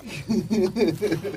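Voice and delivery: a bright, high burst of laughter; real amused laugh. Real, not acted. Laughs at the tiny salary.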